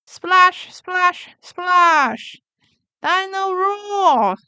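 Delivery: high, loud splashing calls; a little boy shouting in the bath; excited toddler. Acted. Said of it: "says George".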